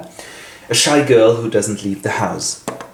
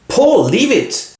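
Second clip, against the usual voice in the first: slow, much higher, pressing; firm rebuke; 13 semitones higher, 3 dB louder, much slower than usual. Acted. Seth tells Paul to stop questioning Beloved, slow and high. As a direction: put a stop to it.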